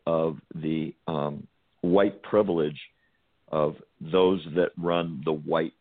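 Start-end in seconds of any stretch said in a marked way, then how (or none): none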